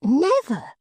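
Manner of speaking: put-on high simple young man, earnest; Barnaby answering eagerly; high eager youth; Barnaby's voice.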